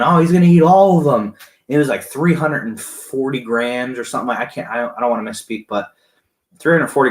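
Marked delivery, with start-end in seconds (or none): 0.0-1.3 high, loud crowd cheer, retold; imitating yelling onlookers. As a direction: yell it like the excited crowd behind you. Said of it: "everybody's behind me yelling".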